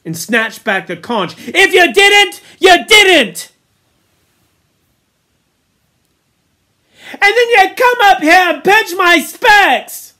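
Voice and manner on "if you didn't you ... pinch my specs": high, loud retort; angry quarrelling. A narrator's reading. Boys quarrel over blame and the conch, angry shouting. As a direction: fire back at full volume.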